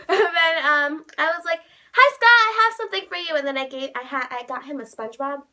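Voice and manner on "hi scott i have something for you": high, loud eager rush of words; excited fan greeting. Retold or imitated. Fan handing Scott a present, excited greeting. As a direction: act out an overexcited hello. Retold gift-giving to her idol.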